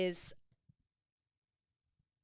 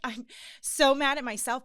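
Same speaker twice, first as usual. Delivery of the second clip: higher and louder, welling up; emotional outburst; 6 semitones higher, 8 dB louder than usual. Retold or imitated. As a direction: let the feeling break through. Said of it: "Oh, I'm not even gonna get emotional".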